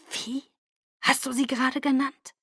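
high, hushed, incredulous question; stunned disbelief. A narrator's reading. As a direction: ask it frozen with shock. Frozen in shock at the nickname.

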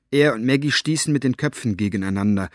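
ordinary delivery

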